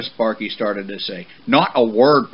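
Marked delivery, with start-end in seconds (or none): 1.5-2.3 loud, stern cut-off; warning. Acted. Father horse silencing his son's protest, loud warning. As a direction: silence him firmly. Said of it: "Papa warned".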